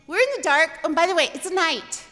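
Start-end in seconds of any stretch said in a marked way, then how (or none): none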